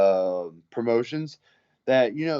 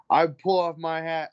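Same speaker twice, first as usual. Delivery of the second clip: normal talking voice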